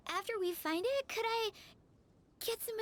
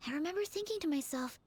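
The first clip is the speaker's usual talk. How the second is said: ordinary talk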